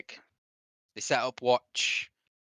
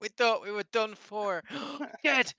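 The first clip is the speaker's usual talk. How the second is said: excited reunion; 11 semitones higher, 3 dB louder than usual. Acted. Villagers greeting rescued men.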